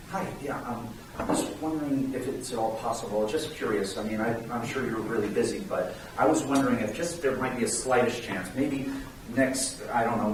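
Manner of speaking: soft, low, hesitant cold caller; mock a timid caller, hedging and apologizing quietly; passive, unsure phone pitch. Shown as a demonstration. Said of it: "you, you become passive".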